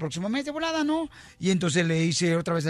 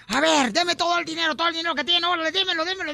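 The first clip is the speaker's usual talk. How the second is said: higher-pitched, pushy robber demand; 8 semitones higher than usual. Acted. Robber orders mother to hand over the money, demanding. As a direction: rattle off an insistent order, pitched up.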